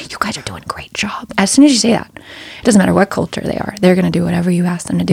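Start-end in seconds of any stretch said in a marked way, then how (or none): 0.1-1.3 whispered, much softer praise. Retold or imitated. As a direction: lean in and whisper the compliment. Confiding praise to parents.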